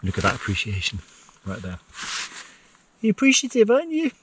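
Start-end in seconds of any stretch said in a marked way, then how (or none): 3.0-4.1 put-on very high, squeaky baby talk for the dog. Owner coos at his dog in a squeaky voice. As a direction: coo at the dog in a squeaky falsetto.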